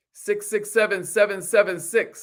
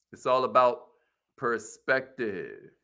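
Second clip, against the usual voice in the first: drawn-out, quieter second half, perspective stretched slowly; slowed emphasis; 6 semitones lower, 4 dB quieter than usual. Shown as a demonstration. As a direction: let perspective sink in slowly. Repeating motto for emphasis.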